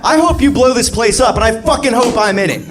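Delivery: high-pitched, worked-up comic rant; acted exasperated rant. Overworked waiter at customer, acted exasperated outburst.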